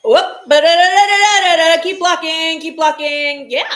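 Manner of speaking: loud, high babble urging players on; real excited fan shouting at the game; excited cheering. Real, not acted. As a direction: holler at the team mid-play. Cheering on a live play.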